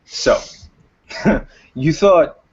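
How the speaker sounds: lightly voiced taunting gang leader with a chuckle; laughing villain. Game master as gang leader mocking captive, laughing. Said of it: "And he is laughing".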